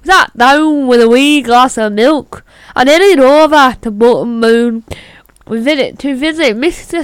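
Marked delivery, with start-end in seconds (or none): 0.0-4.8 put-on Belfast Irish accent. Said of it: "Belfast is an island, not Scotland".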